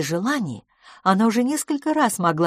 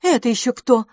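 higher, louder annoyed lady; irritated; 6 semitones higher, 5 dB louder than usual. A narrator's reading. Hostess complains about banging at her door, irritated. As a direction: raise the voice in irritation at the caller. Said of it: "сказала мисс Лафос раздражённо".

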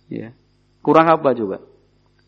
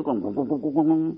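a light imitation of a rumbling exhaust; exhaust noise; 5 dB quieter than usual. Imitating a modified motorbike exhaust.

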